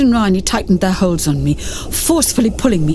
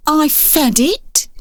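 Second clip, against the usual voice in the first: higher, slow and a bit defensive; 7 semitones higher, much slower than usual. Acted. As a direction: justify yourself, sulky. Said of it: "he said defensively".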